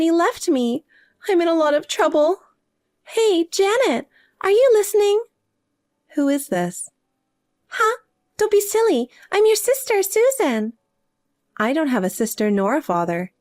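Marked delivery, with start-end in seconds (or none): 3.0-5.2 calling out. Acted. Sister calls out, no answer. 7.7-10.7 indignant disbelief. Acted. Sister shocked at being disowned.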